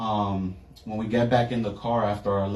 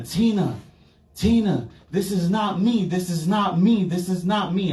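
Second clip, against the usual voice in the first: high, frantic cry to a friend; desperate yelling that this is not me; retold panicked yelling; 9 semitones higher than usual. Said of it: "I started yelling to Tina".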